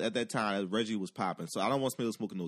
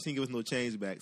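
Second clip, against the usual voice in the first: ordinary delivery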